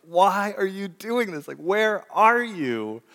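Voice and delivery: high, loud exasperated yell, slowing at the end; huffing and yelling. Retold or imitated. Stomping into the house after being locked out, exasperated high yell. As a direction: stomp in and demand an answer from God. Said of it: "just stomping and huffing".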